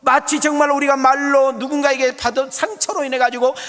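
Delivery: loud, high, driving sermon delivery; impassioned preaching. Real, not acted. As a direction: preach at full force to the congregation. Preaches at full force.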